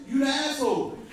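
usual speaking voice

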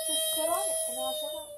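high squeaky voice. Real, not acted. Child talking, voice jumps high.

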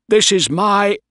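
loud, high protest cut short; indignant outburst. Acted. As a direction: protest loudly as you are seized. Bligh protests being seized, cut off.

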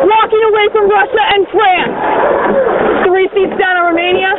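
urgent race calls to the crew; rowing coxswain calls. Acted.

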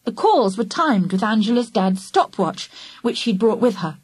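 ordinary delivery, nothing marked

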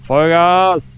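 high, loud battle cry; rallying shout. Real, not acted. Gamer urging his vehicle forward, loud rallying shout. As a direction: yell "Vollgas" as if charging forward. Ramming again.

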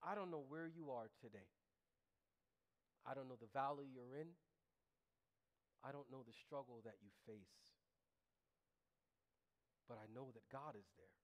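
low, softened sermon voice; gentle pastoral preaching. Real, not acted. Gently addresses the struggling congregation.

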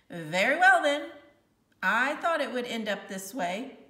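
a hint of a stern teacher accepting defeat; Missus Granger. A narrator's reading.

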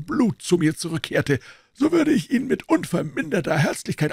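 a hint of a composed doctor; the doctor explaining why he came in, lightly voiced. A narrator's reading. Doctor speaking in the story.

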